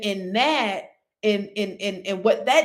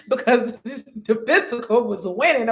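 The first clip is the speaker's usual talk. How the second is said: laughing through words; 4 semitones higher than usual. Real, not acted. Laughing at her weakness.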